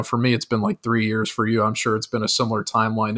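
ordinary talk

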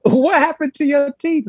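high-pitched baffled question, tickled; mock-astonished, pitched well up; retold astonishment. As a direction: ask it in amused, high-pitched disbelief. Retold question about fallen teeth.

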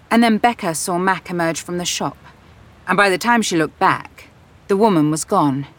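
usual speaking voice

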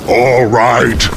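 gruff beast rumble; big yeti's grudging rumble; rumbling great Yeti. A narrator's reading. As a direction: rumble it out like a huge creature. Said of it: "the great Yeti rumble".

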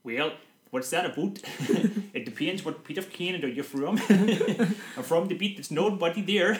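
laughing through a Canadian accent; giggling, slow and broken up. Real, not acted.

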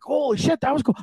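high-pitched, amazed delight; mock-giddy exclamation; retold thrill. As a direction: relive the rush in a high, impressed voice. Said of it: "it was kind of fun, actually".

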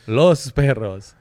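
"Los perros" said slowly, rolled Spanish r. Shown as a demonstration. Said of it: "S at the end, plural".

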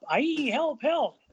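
high, wavering mock wail; mock cry for help. Kent gets up calling for help, mock alarm. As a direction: call out in hammed-up alarm. Someone stands up crying for help.

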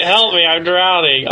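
high, slow cry for help; drowning. Retold or imitated. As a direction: call out like a kid who is drowning. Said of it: "he's that little kid who's always drowning".

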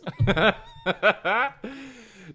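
loud, high burst of laughter; big laugh. Real, not acted. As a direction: crack up loudly.

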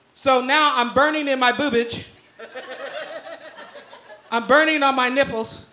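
usual speaking voice

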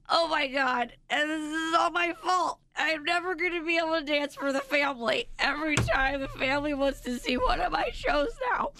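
high, whiny mock despair; imitated hysterics. Daughter blaming herself to mom, mock hysterics. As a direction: lament like a melodramatic little girl.

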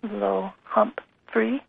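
careful, hesitant attempt at a new language; tentative, broken into separate syllables; halting learner. A narrator's reading. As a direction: sound it out piece by piece. Mother haltingly tries new English words.

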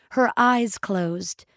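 ordinary delivery, nothing marked

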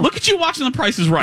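squeaky teasing high voice; high mock voice. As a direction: tease her in a tiny high voice. Teasing a cohost watching TV.

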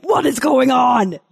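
high, slow, menacing mock demand; Vincent D'Onofrio. Mom walking in on squabbling kids, mock-menacing demand. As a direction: play the interrogating parent, hammed-up. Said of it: "Vincent D'Onofrio all be like".

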